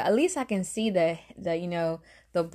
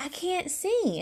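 high, hushed retold plea; mock-panicked, pitched well up; 10 semitones higher, slower than usual. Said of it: "I'm freaking out".

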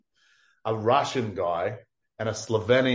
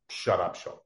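ordinary talk

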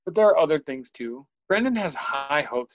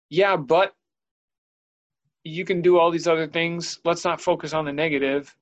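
higher, louder, falsely cheery consolation; mock upbeat reassurance; 5 semitones higher, 4 dB louder than usual. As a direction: play the well-meaning helper who skips the feeling. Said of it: "so I don't automatically say".

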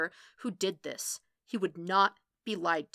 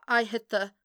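louder, broken-off attempt to remember; halting recollection; 3 semitones higher, 5 dB louder than usual. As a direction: start to recall it, then break off. Matt struggles to remember aloud.

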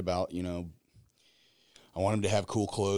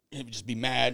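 ordinary delivery

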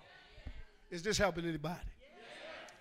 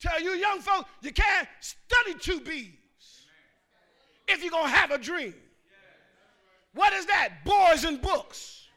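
loud, higher, drawn out sermon voice; full-force preaching; 5 semitones higher, 12 dB louder than usual. Real, not acted. Pastor exhorting the congregation, full-force preaching. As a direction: preach it to the back row.

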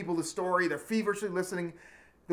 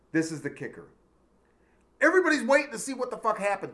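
ordinary delivery

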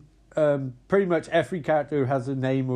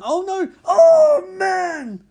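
high, loud horrified cry; shocked yell; 14 semitones higher, 10 dB louder, much slower than usual. Real, not acted. Watching a man's head get cut off, loud shocked cry. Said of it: "speaker reacting to gore".

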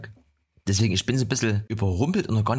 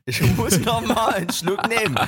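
lachend; 11 semitones higher than usual. Real, not acted. Lacht über eigenen Versprecher.

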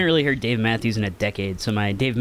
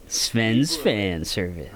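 slow low voice through fan blades; 5 semitones lower, much slower than usual. Acted. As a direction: speak into the spinning fan. Spoken into the fan.

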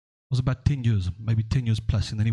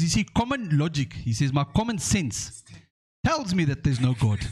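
Nigerian professor voice; 3 semitones higher than usual. Retold or imitated. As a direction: explain it like it's obvious.

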